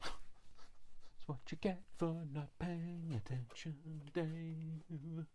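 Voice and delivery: high, slow teasing tone; mock scolding. Crafter teases herself as Dave, mock scolding. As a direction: tell yourself off with a wink.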